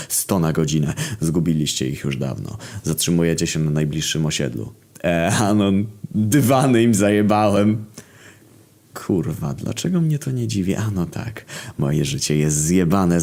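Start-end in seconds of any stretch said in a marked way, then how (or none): 5.0-7.8 higher, louder boastful buddy. Acted. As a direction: brag like a proud little thief. Friend boasts about stolen carpets.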